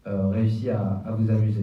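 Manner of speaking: usual speaking voice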